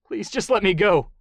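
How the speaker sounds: begging to be let go; desperate plea. Acted. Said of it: "he pleaded desperately".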